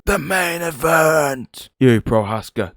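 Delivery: stretched-out ring announcer hype, high and slow; announcer call. Pretend. Introducing the main event to viewers, drawn-out announcer call. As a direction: belt it out like a fight-night announcer.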